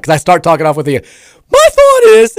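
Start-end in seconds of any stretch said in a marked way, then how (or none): 1.5-2.4 voice cracking. Retold or imitated. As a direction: voice cracks up high and breaks mid-phrase. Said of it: "it cracks, it breaks".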